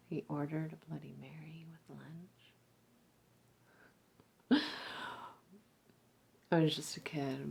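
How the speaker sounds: quiet, intimate confiding, dropping to a whisper; murmured memory offered as an invitation; seductive invitation. Acted. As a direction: draw the listener closer with soft, lingering pauses. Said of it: "a seduction, an invitation".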